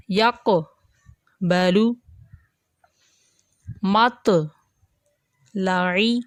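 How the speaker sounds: words drawn out slowly with a light mid-word bounce; light qalqala. Shown as a demonstration. Teacher models Quran words for students: slow, light bounce. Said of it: "اس طرح سے آپ نے ان لفظوں کو ادا کرنا ہے".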